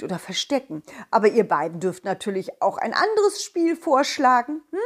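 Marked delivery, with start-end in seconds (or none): none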